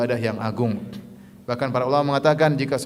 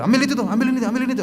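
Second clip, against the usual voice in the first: higher, curt commanding tone at the husband; bossy orders; 10 semitones higher, much faster than usual. Retold or imitated. Wife barking orders at her husband, mock curt and higher. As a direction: boss him around impatiently. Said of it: "Kalau sama suami tidak".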